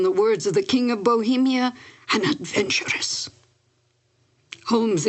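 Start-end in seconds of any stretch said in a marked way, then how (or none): 2.1-3.3 breathy whisper on the word; hushed, knowing whisper; whispered label. Retold or imitated. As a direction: drop to a secretive hush for the scandalous word. Quoting the king's scornful label.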